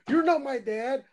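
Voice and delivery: high-pitched indignant kid impression; mock mad kid. Teased kid snapping back at a fake dad, mock mad. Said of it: "he sounds like he's really mad".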